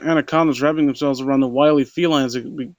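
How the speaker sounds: ordinary delivery, nothing marked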